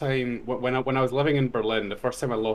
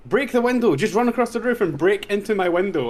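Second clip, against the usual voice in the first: urgent instruction; 9 semitones higher, 4 dB louder than usual. Retold or imitated. Neighbor voiced, urgent instructions.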